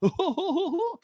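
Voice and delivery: high cartoon chuckle; hammed-up high, dopey giggle; Goofy laugh. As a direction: hoot out a silly cartoon laugh. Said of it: "Go-, Goofy".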